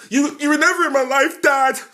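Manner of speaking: high, loud mock child's cry; a hammed-up film child blaming Dad; child accusing dad. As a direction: whine it like a wronged kid on TV.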